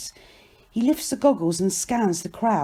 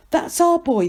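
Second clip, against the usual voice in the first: high, moderately raised appeal; urgent cry; 10 semitones higher, 4 dB louder than usual. A narrator's reading. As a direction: cry out to the crowd. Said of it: "they cry".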